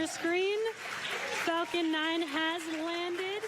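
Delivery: beaming with real excitement; excited. Real, not acted. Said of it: "so very exciting for us".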